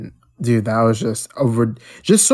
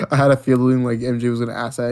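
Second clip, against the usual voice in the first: usual speaking voice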